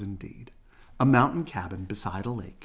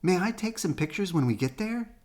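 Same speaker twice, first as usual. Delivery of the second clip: Brother cub voice; 8 semitones higher than usual. A narrator's reading. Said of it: "said Brother".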